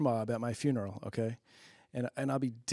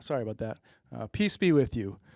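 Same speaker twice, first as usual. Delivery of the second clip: ordinary delivery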